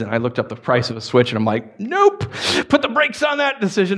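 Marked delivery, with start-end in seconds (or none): 1.8-4.0 high, emphatic nope; mock firm no. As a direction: slam the brakes on it. Refusing Switch price.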